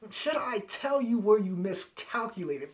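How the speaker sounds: imitating an arrogant fighter's gloating; arrogant Vegeta. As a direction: lord it over a beaten opponent. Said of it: "Vegeta is arrogant as ever".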